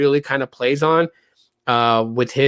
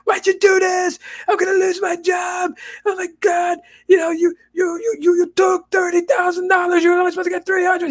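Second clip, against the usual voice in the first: much higher, panicked shouting scammer; freaking-out scammer. Retold or imitated. Scammer panicking at the victim over the refund, high frantic shouting. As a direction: squeal it out like a scammer losing his job. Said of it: "the scammer is gonna freak out and be like".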